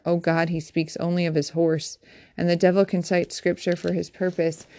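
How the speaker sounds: plain reading voice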